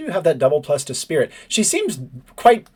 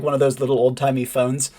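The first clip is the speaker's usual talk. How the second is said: normal talking voice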